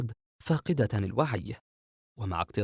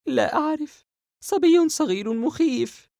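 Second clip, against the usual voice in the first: put-on much higher, louder, slow scared girl; frightened young girl; 7 dB louder, slower than usual. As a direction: drag out the fear, high and slow. Sara voiced by narrator. (صوت فتاة مذعورة بطيء وعالٍ)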